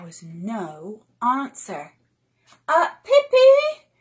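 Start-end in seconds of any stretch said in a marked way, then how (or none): 2.7-3.9 high, loud anxious call; children calling out, worried and loud. Acted. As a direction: call her name with worry. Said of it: "called Annika and Tommy".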